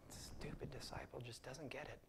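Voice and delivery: whispered scornful mutter; contemptuous whisper. Retold or imitated. Preacher voicing a scornful Jesus in a whisper. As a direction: hiss the scorn under your breath. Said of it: "the look of Jesus was like".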